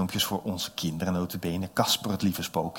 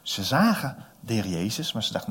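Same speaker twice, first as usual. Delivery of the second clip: usual speaking voice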